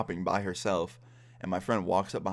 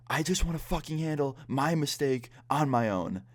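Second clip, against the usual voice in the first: mock angry girlfriend; 7 semitones higher than usual. Angry cheater voiced in story.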